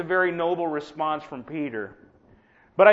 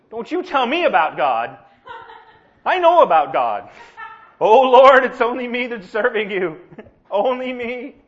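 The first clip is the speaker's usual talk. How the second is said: laughing mock outrage, much louder; mock indignant, laughing; 3 semitones higher, 8 dB louder than usual. Preacher imitating his own fed-up self, laughing mock rant. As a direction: rant at anyone preaching trust, half joking. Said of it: "slap the ugly off of anybody".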